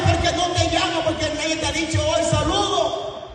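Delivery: very high, strained cries of reproach; impassioned preacher's challenge, high-pitched; high preaching rebuke. Acted. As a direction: cry out each question and answer way up high. Preacher challenges those crying over people.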